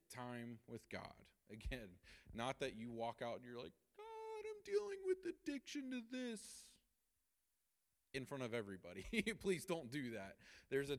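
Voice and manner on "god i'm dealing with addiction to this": mock public confession.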